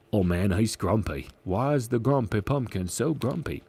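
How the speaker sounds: normal talking voice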